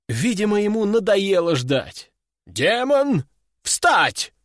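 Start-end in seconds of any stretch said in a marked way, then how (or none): 2.5-4.3 shouted command. Acted. Master shouting an order at the demon. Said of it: "выкрикнул он".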